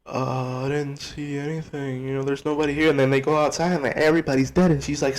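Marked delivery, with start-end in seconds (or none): 0.0-2.9 sheepish quiet excuse. Retold or imitated. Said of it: "standing there like".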